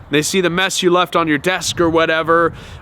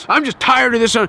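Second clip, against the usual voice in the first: mock scream; 7 semitones higher than usual. Narcissist screaming about the mess, mock exasperated yell. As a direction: blow up in fed-up frustration. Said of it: "they'll scream".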